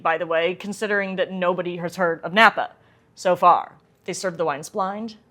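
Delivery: normal talking voice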